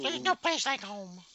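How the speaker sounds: Dorothy voice. Pretend. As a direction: sigh it like Dorothy. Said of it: "I'll get you, my pretty".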